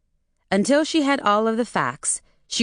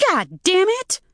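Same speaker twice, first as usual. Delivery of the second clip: outburst; 12 semitones higher than usual. A narrator's reading. Furious mother curses into the phone. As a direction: spit the curse out.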